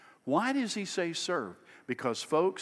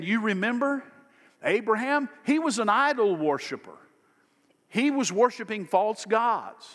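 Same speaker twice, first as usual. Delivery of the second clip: raised, driving preacher voice; fervent preaching; 6 semitones higher, 3 dB louder than usual. Acted. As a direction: preach it out with rising fervour. Preacher rises to retell history.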